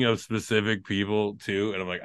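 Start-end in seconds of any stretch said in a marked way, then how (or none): none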